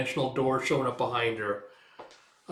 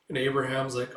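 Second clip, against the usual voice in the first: ordinary talk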